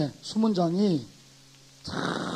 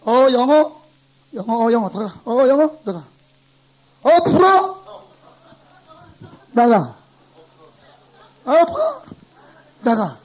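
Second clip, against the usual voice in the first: loud, high barking gate guard; gatekeeper; 3 semitones higher, 8 dB louder than usual. Acted. Teacher plays a gatekeeper turning away French speakers: loud barking guard. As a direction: bark at each visitor like a stern gatekeeper. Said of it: "딱 수문장 서가지고".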